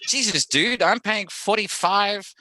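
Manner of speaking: high-pitched disbelieving outburst; mock incredulous. Brit reacting to Americans moaning about tax, incredulous. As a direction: pitch it way up, aghast at the figure. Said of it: "I'm like".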